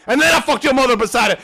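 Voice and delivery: taunting boast. Pretend. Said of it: "Pass was like".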